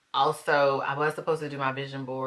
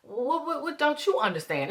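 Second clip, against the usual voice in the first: scolding myself; 8 semitones higher than usual. Retold or imitated. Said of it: "I think back and I'm like".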